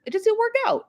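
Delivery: mock sweet line. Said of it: "You just hear".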